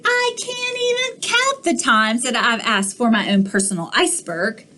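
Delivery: ordinary delivery, nothing marked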